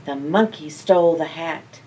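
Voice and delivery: usual speaking voice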